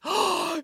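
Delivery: high, audible intake of breath; shocked gasp. A narrator's reading. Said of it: "She gasped".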